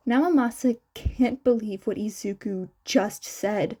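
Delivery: usual speaking voice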